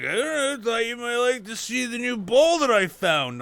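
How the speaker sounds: put-on high, sheepish old codger voice; mock dopey guy. Retelling the stoned guy's excuse.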